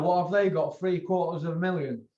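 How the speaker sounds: normal talking voice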